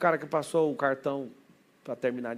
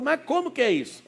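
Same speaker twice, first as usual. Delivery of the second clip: baffled question; 10 semitones higher, 4 dB louder than usual. Retold or imitated. Listener's baffled question, retold.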